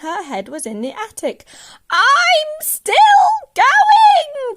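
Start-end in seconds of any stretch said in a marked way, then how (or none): 1.9-4.6 put-on very high, loud old-woman shriek; stretched, full-force crone's holler; old screechy voice. A narrator's reading. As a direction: screech it gleefully from far above. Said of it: "came this old screechy voice".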